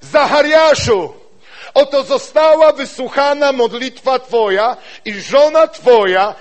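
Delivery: preaching at full force. Acted. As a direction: proclaim it like a herald. Preacher reads angel's words loudly. (głośne, podniosłe głoszenie słów anioła)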